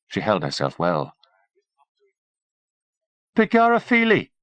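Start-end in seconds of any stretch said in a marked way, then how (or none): 3.3-4.3 high, hammed-up Irish lilt; exaggerated stage-Irish exclamation; mock Irish accent. A narrator's reading. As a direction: put on a mock Irish accent, pitched right up. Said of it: "he said in a mock Irish accent".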